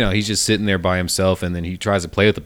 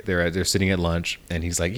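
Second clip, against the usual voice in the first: ordinary talk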